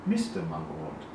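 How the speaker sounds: plain reading voice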